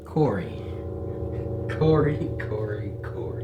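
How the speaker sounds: horror trailer voice. Acted. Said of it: "villain calls captive Corey".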